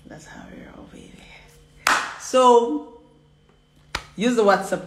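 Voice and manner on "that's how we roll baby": hushed, much lower aside; sly whisper. Real, not acted. Teacher hinting at the practical class, whispered. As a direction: drop to a conspiratorial whisper.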